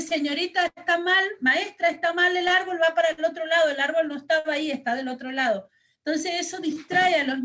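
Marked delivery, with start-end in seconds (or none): 0.0-5.7 put-on higher, louder child correcting the teacher; a hint of a small girl insisting; lightly voiced girl protesting to her teacher; insistent little girl. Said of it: "Y entonces la niña le dice".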